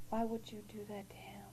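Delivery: hushed, aching question; whispered plea. Retold or imitated. A mother whispers to God over her sick son.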